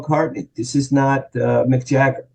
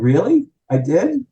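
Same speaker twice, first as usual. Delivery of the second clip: shocked disbelief; 7 semitones higher than usual. Retold or imitated. Shocked to learn the blunder.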